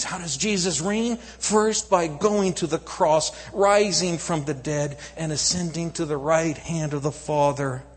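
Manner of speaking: loud, high, driving preacher cadence; full-force preaching. Acted. Preacher building to a climax before the congregation. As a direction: hammer each no home to the crowd.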